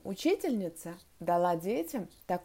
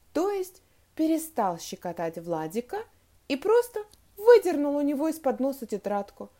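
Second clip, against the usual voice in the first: ordinary delivery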